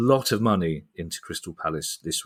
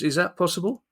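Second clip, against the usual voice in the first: ordinary delivery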